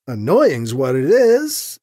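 ordinary delivery, nothing marked

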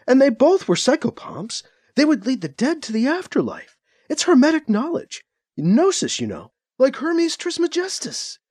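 ranting mother on live TV; frantic rant. A narrator's reading. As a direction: pour it out like a woman unravelling. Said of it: "Ruth continued ranting".

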